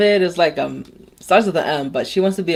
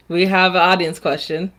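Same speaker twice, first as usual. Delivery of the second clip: usual speaking voice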